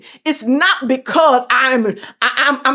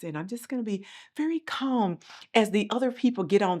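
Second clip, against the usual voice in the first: calm quiet voice; 10 dB quieter, faster than usual. Shown as a demonstration. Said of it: "maybe I'm gonna talk like this".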